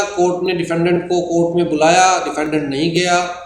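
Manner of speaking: ordinary talk, nothing marked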